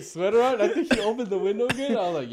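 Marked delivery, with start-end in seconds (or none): none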